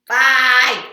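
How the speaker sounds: loud, high goodbye; called-out goodbye. Real, not acted. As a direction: call it out to someone leaving. Calling goodbye to child helper.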